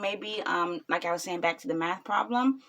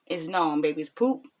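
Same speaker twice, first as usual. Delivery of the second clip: usual speaking voice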